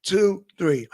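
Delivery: ordinary talk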